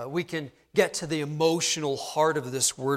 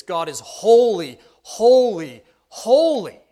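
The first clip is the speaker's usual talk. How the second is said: each HOLY louder and punched, pitch climbing; building emphasis; 7 semitones higher, 10 dB louder, slower than usual. Acted. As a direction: make every "holy" ring higher than the last, pause between. Quotes threefold holy as superlative.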